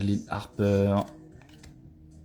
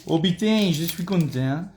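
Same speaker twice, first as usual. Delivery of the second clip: a hint of a southern French accent, higher; joyful words in a light southern French accent; 8 semitones higher than usual. Real, not acted. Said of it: "je prends l'accent du sud". (accent du sud léger et joyeux)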